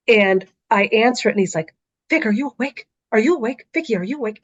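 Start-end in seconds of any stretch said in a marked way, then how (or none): 2.1-4.4 worried dad. Retold or imitated. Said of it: "it sounds like there's something wrong".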